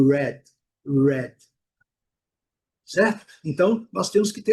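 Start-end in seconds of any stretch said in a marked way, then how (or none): 0.1-1.3 red with lips pushed out into a pout; rounded English r. Shown as a demonstration. Teacher modeling red with rounded lips. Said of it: "é um bico mesmo".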